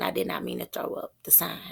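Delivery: hurried hushed whisper under the breath. Real, not acted. Unvoiced fast aside at start.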